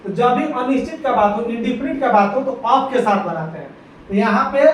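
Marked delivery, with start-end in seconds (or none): none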